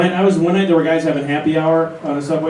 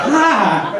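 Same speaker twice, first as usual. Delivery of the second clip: mock squeal; 8 semitones higher than usual. Squirrel's silent scream imitated.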